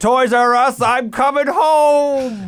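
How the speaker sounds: high, loud and dragged out; dramatic dying old man. Retold or imitated. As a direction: wail it like a heartbroken old man. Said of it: "He goes like".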